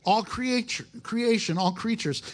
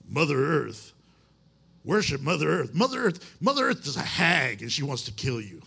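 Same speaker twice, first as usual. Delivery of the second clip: lightly voiced Ken Graves, mostly own voice with a shifted tone on the last line; a mild take on the friend's preaching voice, hag line punched low; Ken Graves impression; 4 semitones lower than usual. Preacher doing his wife's requested voice of Ken Graves, a light imitation. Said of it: "My wife said I need to try and do the voice".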